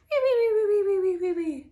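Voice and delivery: high "wee-wee" whistles sliding downward; descending cartoon snore; comic snore whistle. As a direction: imitate snoring friends with squeaky whistles. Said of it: "snore on".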